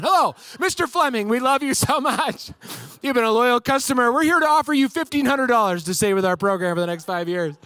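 hammed-up pleading to keep a customer; desperate retention team. Retention agents begging him not to cancel, hammed-up fawning. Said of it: "more impressions of DirecTV people".

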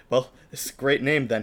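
ordinary talk

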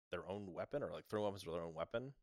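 ordinary talk

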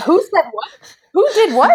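laughing, can't believe it; laughing disbelief. Real, not acted. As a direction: giggle out the confusion. Disbelieving retold reaction.

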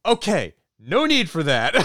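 high, loud startled whoa, then waving it off; startled backing off. Retold or imitated. Father hearing gory detail from daughter, loud startled recoil. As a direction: recoil from too much detail. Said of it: "And he's like".

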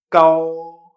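kao held long on a level tone, no puff of air; first tone. Shown as a demonstration. Teacher modelling the example, kao in a sustained first tone. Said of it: "G A O en primer tono".

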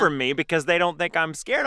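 normal talking voice

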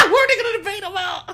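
asked high through laughter; high laughing disbelief; laughing question. Real, not acted. Laughing while asking co-host.

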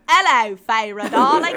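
loud, hammed-up bad Cockney; horrific Cockney accent. Shown as a demonstration. Host attempting dark lord's English voice, horrific Cockney accent. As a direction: belt it in a botched Cockney accent. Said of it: "He's just got a horrific Cockney accent".

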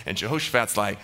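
normal talking voice

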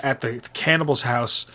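ordinary talk

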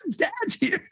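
very high squeaky voice for the thrilled beagles; excited dogs. Retold or imitated. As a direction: squeal it like dogs going wild for their owner. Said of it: "it was like".

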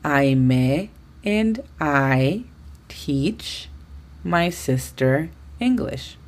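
very slow, each English word laid out; slow model sentence. Shown as a demonstration. Teacher modeling the English sentence for students, slow and careful. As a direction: model it slowly for learners. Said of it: "lo correcto sería decirlo en inglés de la siguiente manera".

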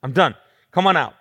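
loud, fed-up declaration; acted exasperation with falling endings; Jesus, tapped out. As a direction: blurt it out at the end of your patience. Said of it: "emotionally tapped out".

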